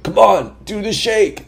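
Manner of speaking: high, loud imitation of a pushy man; mock insistent cheer; eager urging. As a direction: egg them on, hammed up. Man urging handshake, retold.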